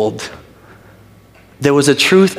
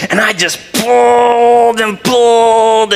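slow, loud, high effort; mock straining effort; 9 semitones higher, 4 dB louder, slower than usual. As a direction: push the words out as if pulling hard. Straining to break apple.

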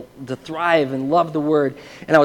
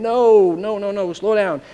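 raised, high, drawn-out first cry; mock scream of alarm, easing after the first word; retold inner scream; 5 semitones higher, 4 dB louder, slower than usual. His spirit objects to the stranger's words, mock screaming. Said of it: "everything in my spirit was screaming".

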